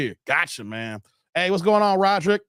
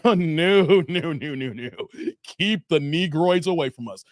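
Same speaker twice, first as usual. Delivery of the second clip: put-on prissy voice, dropping low to say no; lightly voiced fearful gatekeepers; 4 semitones lower than usual. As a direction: play the nervous people shooing them away. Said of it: "they're like".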